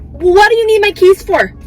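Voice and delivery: high, loud indignant outburst at an absent thief; loud, incredulous confrontation; exasperated demand. Pretend. Mock confronting the absent burglar.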